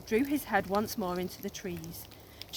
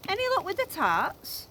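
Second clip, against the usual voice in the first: high, louder friendly question; dinosaur voice; 11 semitones higher, 5 dB louder than usual. A narrator's reading. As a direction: ask it kindly like a big beast. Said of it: "said the dinosaur".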